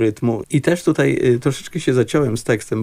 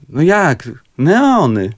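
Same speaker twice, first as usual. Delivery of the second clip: louder, higher, with certainty; friend's confident answer; 5 semitones higher, 5 dB louder, much slower than usual. Retold or imitated. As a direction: announce the title like it's obvious. (pewna siebie, głośniejsza odpowiedź kolegi)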